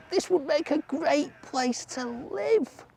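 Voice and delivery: high, slow little mouse thinking aloud; a tiny mouse delighted with the mitten; squeaky mouse voice. A narrator's reading. Mouse, pleased, to itself: high slow squeak.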